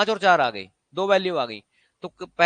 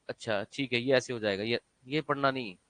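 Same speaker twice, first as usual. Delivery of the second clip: softer, muttered student reading solution; half-mumbled like a student nodding through answers; mock student mumble; 7 semitones lower, 7 dB quieter than usual. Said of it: "दिमाग को चलने का time ही नहीं दे रहे आप". (छात्र की धीमी बुदबुदाती नकल)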